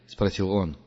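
usual speaking voice

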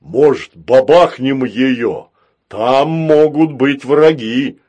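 loud, slow, eager robot voice; eager to fire, loud and slow; gleeful robot. A narrator's reading. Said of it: "обрадовался Грохотун".

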